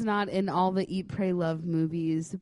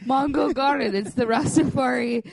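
loud ad announcer; 5 semitones higher, 7 dB louder than usual. Pretend. Parody ad announcement.

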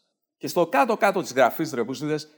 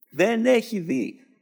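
mock pity echo; 7 semitones higher, much slower than usual. Echoing in high put-on voice.

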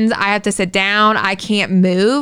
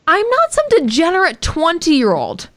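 higher, offended and insistent; mock-outraged protest, climbing higher; indignant retort; 9 semitones higher than usual. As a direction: put them in their place, pitched up. Her indignant reply to friends' texts.

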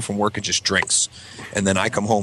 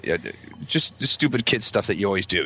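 usual speaking voice

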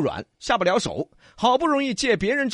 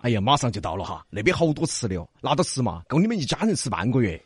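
low, softened luring voice; coaxing young beggar; 7 semitones lower, 3 dB quieter, faster than usual. A narrator's reading. Young man promises the girl food, coaxing. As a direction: lure her along in a low voice.

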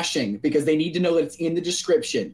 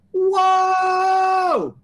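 mock amazed shout; 11 semitones higher than usual.

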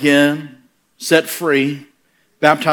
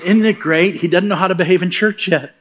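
amused Lord; 6 semitones higher than usual. Retold or imitated. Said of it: "He said".

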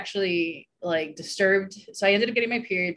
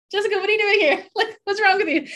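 surprised neighbor; 13 semitones higher, 5 dB louder, faster than usual. Retold or imitated. Neighbor sees her on gurney.

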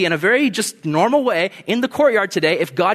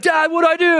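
high, pleading and frantic; hammed-up helpless cry for help; acted panic; 12 semitones higher, 4 dB louder than usual. Son phoning his dad about a snake, high comic panic.

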